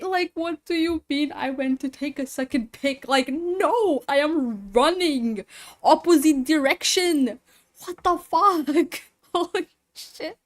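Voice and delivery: high, loud panicked yelling. Real, not acted. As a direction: yell in frightened disbelief. Game scare, yelling in panic.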